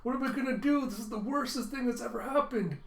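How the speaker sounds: put-on high-pitched child in a panic; hammed-up panic; panicking. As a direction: wail it like a kid facing disaster. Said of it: "panicking, going".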